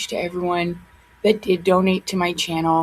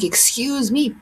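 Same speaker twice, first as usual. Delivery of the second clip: retorting to the commenter, offended; indignant "excuse me" back; 3 semitones higher, slower than usual. Retold or imitated. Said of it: "I was like".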